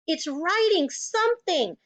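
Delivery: high, astonished cry; excited high-pitched exclamation; screamed. A narrator's reading. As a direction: call out the alarm like a startled schoolboy. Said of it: "screamed Nigel".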